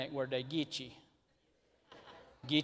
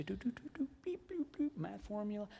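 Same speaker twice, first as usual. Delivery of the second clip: mock computer beeps; 5 semitones higher, 6 dB quieter than usual. Mimics a computer solving formula.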